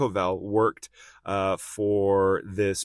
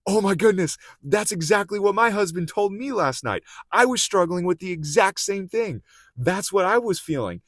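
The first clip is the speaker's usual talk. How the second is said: much higher, louder, breathless astonishment; amazed realization; 11 semitones higher, 5 dB louder than usual. Retold or imitated. Karen realizes Rick's pastor said what her husband said, high astonished squeal. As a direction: squeal it out in jaw-dropping amazement. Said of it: "Karen's jaw is just on the floor".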